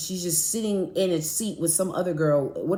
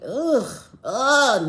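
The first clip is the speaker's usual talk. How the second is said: loud, disgusted ugh in a put-on voice; mock disgusted ugh; 5 semitones higher, 6 dB louder than usual. Mimicking the girl's reaction, mock disgusted ugh. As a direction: groan like a sneering girl.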